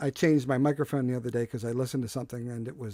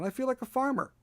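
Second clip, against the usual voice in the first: I feel like a farmer, pitched high like a mock-amazed cry; mock exclaim; 11 semitones higher than usual. Host joking to viewers about his chicken-feeding life, high mock cry. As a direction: toss it up high in playful disbelief.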